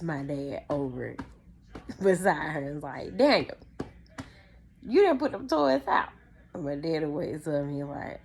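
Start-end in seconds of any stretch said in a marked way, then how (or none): 3.2-6.1 loud, high-pitched exasperated reproach; scolding wife. Retold or imitated. Mom hitting dad awake over toys, loud scolding. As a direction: jolt a sleeping husband with the scolding.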